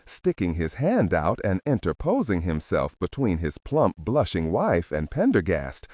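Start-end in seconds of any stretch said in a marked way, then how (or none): none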